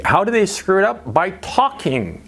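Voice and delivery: ordinary delivery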